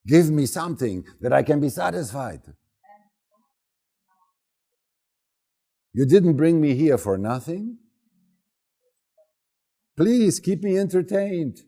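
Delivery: loud mock-demanding little girl; demanding daughter. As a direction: play the spoiled kid insisting on fun.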